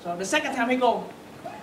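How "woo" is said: sudden loud whoop; loud kiai. Shown as a demonstration. Teacher demonstrating Bruce Lee's cry to student, loud whoop. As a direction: startle them with the cry. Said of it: "sorry, you reacted to my voice".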